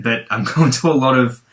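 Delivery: ordinary talk, nothing marked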